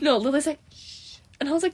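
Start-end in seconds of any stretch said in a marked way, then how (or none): 0.7-1.2 hushing. Retold or imitated. Retold friend hushing her.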